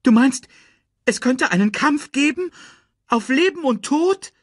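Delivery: very high, alarmed questioning; worried. A narrator's reading. Timon asks Nala about a fight to the death, worried. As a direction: spell out the danger in high-pitched alarm. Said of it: "mit besorgter Stimme".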